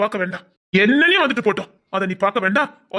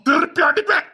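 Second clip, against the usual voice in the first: slow, higher, shouted threat; angry shouting; 6 semitones higher than usual. Acted. Husband shouting revenge threats at his absent wife, furious. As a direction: spit out each word of the vow in rage. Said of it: "எதுக்கு கோவத்துல கத்திட்டு இருக்கீங்க?".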